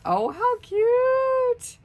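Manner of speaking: high, loud, slow squeal of delight; squealing with delight at a cute gift. Real, not acted. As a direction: drag out a high, loud coo over something adorable. Delighted by the mail.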